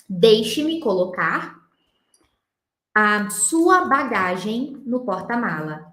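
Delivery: "Deixe-me colocar" spoken slowly for copying; slow dictation. Shown as a demonstration. Teacher dictates the Portuguese sentence slowly for students. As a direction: dictate it so they can write. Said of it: "Como que vocês poderiam falar assim".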